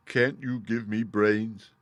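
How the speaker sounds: slow, pleading straw-man voice; Scarecrow. A narrator's reading. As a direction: ask slowly like a simple scarecrow. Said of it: "asked the Scarecrow".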